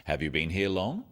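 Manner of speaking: tune rising on long at the end. Shown as a demonstration. Teacher showing a yes/no question with a rising tune. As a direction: let the question rise up on long. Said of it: "so they have a rising tone".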